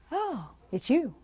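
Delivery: indifferent. Retold or imitated. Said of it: "A few years later".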